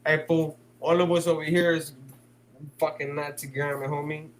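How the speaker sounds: ordinary delivery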